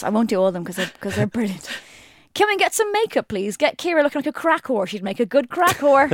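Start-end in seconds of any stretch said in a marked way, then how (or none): 2.3-6.1 mock presenter, higher throughout; presenter quote. Reading a Partridge-or-Madeley quote as a mock host. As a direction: play the pompous TV host. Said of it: "Madeley or Partridge?".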